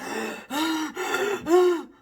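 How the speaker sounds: sharp high gasp for air; a loud retold gasp of panic; panicked gasp. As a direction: gasp like you can't breathe. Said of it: "I was like".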